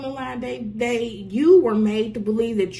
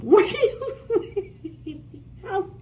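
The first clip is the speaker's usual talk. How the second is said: laughing; 10 semitones higher than usual. Real, not acted. Laughing in disbelief.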